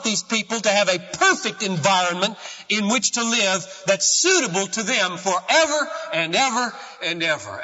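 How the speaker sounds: high, loud pulpit preaching; soaring sermon climax; full-force preaching. Acted. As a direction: preach it out to the whole church.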